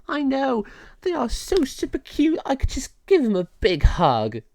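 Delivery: very high, bubbly voice; gushing fairy. Acted. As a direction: gush over how cute they are. Lilu gushes over cats.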